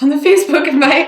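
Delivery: loud, laughing through the words; laughing joke. Real, not acted. Student jokes about Obama answering the invite, laughing. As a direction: break into laughter as you say it.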